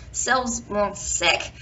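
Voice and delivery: ordinary delivery, nothing marked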